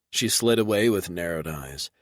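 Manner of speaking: usual speaking voice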